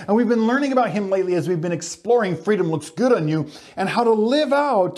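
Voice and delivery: usual speaking voice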